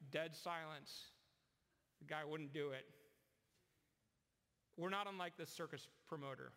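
usual speaking voice

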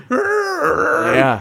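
very high helium cry; helium bear wail. Acted. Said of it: "bear on helium".

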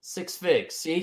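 usual speaking voice

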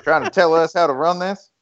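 hammed-up Texan indignation; Joe Jamel. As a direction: imitate an offended Southerner grumbling. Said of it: "Joe Jamel will be like".

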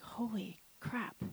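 hushed disbelief; awed whisper. Retold or imitated. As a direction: let it out in a slow hush. Stunned reaction to the voice.

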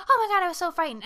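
high, loud burst of pretend panic; hammed-up scared relief; mock fright. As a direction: imitate a frightened movie heroine gushing. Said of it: "of being like".

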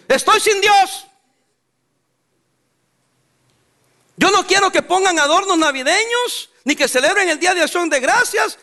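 high, emphatic voicing of a protesting atheist; defiant atheist. Preacher voices atheists objecting to religious holidays, mock defiance. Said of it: "pero los ateos dicen".

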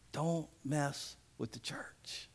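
hushed, slow whisper of the warning; slow, soft stage whisper. Shown as a demonstration. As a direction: lean in and whisper it like a secret threat. Repeated warning, then whispered.

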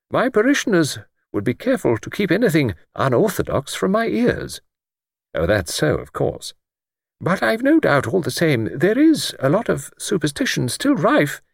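put-on high, gentle voice of a stooping old clergyman; mild old vicar. Old vicar answering Luke, gently pitched high.

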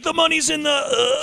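high, trailing dying voice; high dying gasp. Retold or imitated. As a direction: fade out mid-sentence like dying.

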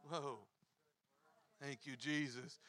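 low, soft murmur of thanks; quiet prayer. Real, not acted. Ending the sermon praying, low and soft. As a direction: breathe it as a prayer. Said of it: "prayer after sermon".

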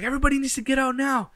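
high, loud, fast order; guy yelling at everyone to leave now; urgent shout. Retold or imitated. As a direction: yell at the whole party to clear out. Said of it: "so he's like".

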